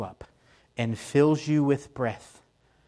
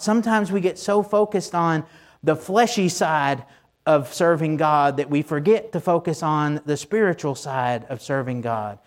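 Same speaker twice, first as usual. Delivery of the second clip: normal talking voice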